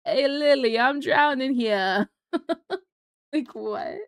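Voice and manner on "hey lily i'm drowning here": put-on Jersey accent. Tony calls to Lily while drowning, mock Jersey accent.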